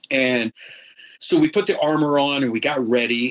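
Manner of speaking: normal talking voice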